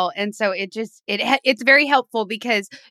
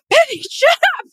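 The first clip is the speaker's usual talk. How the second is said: much higher shrill yell at the cat; shrieking at the cat; 5 dB louder than usual. Retold or imitated. Yelling at her cat Penny during recording, shrieking. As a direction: shriek at your pet to shut up. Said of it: "me just shrieking at the cat".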